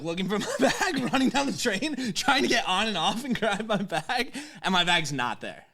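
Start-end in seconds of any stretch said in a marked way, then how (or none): 0.0-4.3 laughing. Real, not acted. Laughs recalling frantic search.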